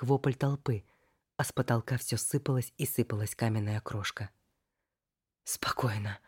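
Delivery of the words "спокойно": hushed self-calming whisper. Acted. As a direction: whisper it to yourself. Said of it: "inner self-calming whisper".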